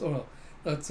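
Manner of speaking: usual speaking voice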